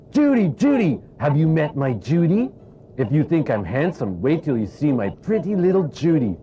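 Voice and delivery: Punch puppet voice. Acted. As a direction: squawk it out like Mr Punch showing off his wife. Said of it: "Enter Punch".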